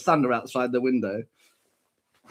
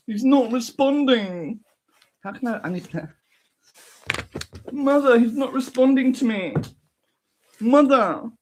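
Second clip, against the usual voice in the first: whining son; 9 semitones higher than usual. Pretend. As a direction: plead for mother's help. Son calls for mother.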